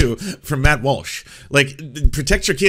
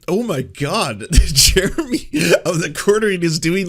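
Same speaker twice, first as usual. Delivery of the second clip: raised voice cracking into a laugh; laughing disbelief; 6 semitones higher, 6 dB louder than usual. Retold or imitated. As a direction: exclaim it as laughter breaks through. Recounts own laughing disbelief.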